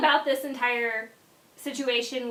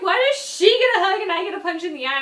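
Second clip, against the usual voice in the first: higher, louder, faster whine; mock complaint; 6 semitones higher, 6 dB louder, faster than usual. Punched friend griping about the hug, fast whine. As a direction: protest like you've been wronged.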